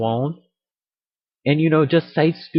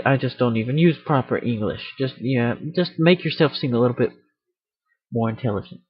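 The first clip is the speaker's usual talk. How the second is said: usual speaking voice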